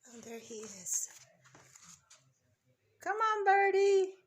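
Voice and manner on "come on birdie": calling out. Real, not acted. Calling a bird to the feeder.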